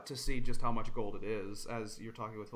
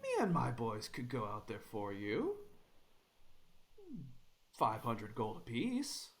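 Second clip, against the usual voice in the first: sly mocking. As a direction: sneer the offer. Bandit sly offer.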